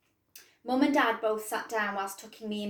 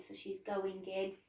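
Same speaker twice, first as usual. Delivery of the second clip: usual speaking voice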